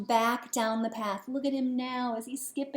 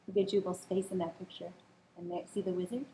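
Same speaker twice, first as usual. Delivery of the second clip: ordinary delivery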